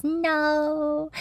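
put-on little girl. Little daughter answering her dad, high childlike voice. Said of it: "And the little girl goes".

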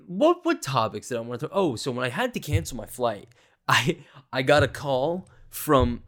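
ordinary talk

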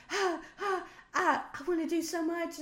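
high breathless gushing; giddy excitement. Retold or imitated. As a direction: gush like you're high. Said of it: "this whole heartfelt".

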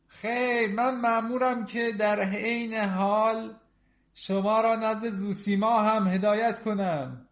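high, loud, slow formal declaration; loud ceremonial priest voice; priest on duty. Acted.

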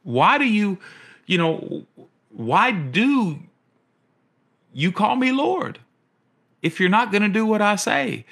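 preacher voicing Jesus in high, disbelieving exasperation; exasperated Jesus. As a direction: ask it as if truly bewildered by them. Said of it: "I think he's like".

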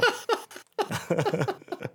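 high burst of laughter. Real, not acted. Laughing at his story.

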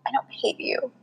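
soft, confiding whisper; hushed confession. Retold or imitated. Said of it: "she was like".

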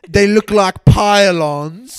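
high, loud, drawn-out British wail; very slow British caricature; mock British. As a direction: cry it out in hammed-up British. British caricature about teeth.

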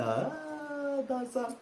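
ordinary delivery

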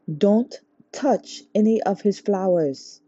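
slow, firm, every word weighted; stressed warning. A narrator's reading. Chi-Chi warning friends at the gate, slow and firm. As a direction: press the warning home slowly. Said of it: "Chi-Chi stressed again".

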